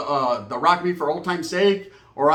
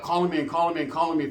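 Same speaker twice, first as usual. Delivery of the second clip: usual speaking voice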